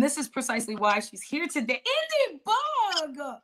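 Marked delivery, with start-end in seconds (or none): 2.3-3.4 drawn-out excited announcement, higher; announcing. Real, not acted. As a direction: call out her name like a host. Host announcing guest entrance.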